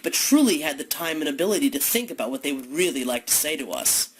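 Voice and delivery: normal talking voice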